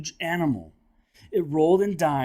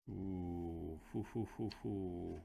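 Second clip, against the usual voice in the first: spooky ghostly ooh; 8 semitones lower, 15 dB quieter, slower than usual. Acted. Spooky reaction after dinosaur sighting.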